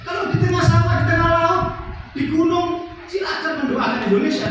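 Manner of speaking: high, loud listing with rhetorical force; preaching. Real, not acted. Preacher listing where others may pray freely, high loud preaching. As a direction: ring out each place to the crowd.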